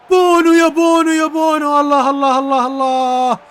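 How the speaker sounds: high, loud cheer for the keeper; excited cheering. Real, not acted. Streamer cheering his keeper's big save, loud high cheer. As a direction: yell his name in delight.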